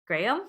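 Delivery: Graham opened out into "gray-um"; two-syllable Graham. Shown as a demonstration. Wife shows how they say it, two-syllable Graham. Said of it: "almost like, two syllables".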